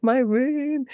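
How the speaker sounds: high-pitched imitation of a dismayed brother; retold child's lament over his room; mock wail. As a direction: whine it high, drawn out in dismay.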